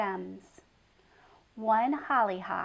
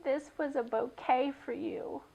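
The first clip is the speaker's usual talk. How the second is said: shaky voice; 4 semitones higher, 3 dB quieter than usual. Acted. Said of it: "she said in a shaky voice".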